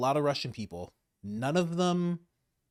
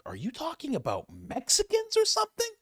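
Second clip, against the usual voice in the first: incredulous high; 14 semitones higher than usual. Retold or imitated. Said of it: "And I was like".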